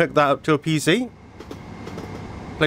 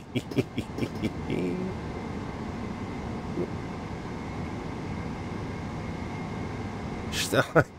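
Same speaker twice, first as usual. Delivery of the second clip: long, quiet, breathy giggling; long giggle; 5 semitones higher, 13 dB quieter than usual. Real, not acted. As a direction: keep giggling softly to yourself. Delighted by the train ride.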